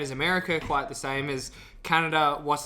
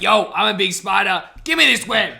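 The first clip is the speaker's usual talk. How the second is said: louder, lightly voiced spider robber; mock spider hijacker; 3 semitones higher, 7 dB louder than usual. Huntsman hijacking another spider's web, mock robber demand. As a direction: play a swaggering spider demanding the goods. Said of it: "They're like".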